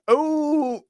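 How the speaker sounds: high, drawn-out mock howl; playful giant's wail of rage; imitated angry roar. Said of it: "when he got angry, must have been".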